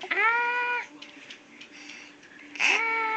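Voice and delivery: high, sustained "ah"; high held cry to coax a scream; demonstrated scream. Shown as a demonstration. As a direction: model a short, controlled high scream.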